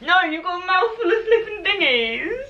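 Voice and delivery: high, laughing protest. Real, not acted. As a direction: laugh while you object. Laughing at partner's full mouth.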